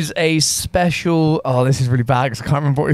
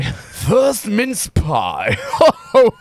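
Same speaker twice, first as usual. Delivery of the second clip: slow, drawn-out Santa with ho-hos; Santa Claus; 5 semitones higher, much slower than usual. Acted. Co-host teased about his award voice, Santa Claus. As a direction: stretch it into a jolly Santa laugh. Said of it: "Why do you sound like Santa Claus".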